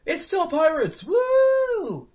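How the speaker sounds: high excited whoop over pirates; excited shout. Retold or imitated. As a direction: cheer it out, thrilled. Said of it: "I'm kinda done with".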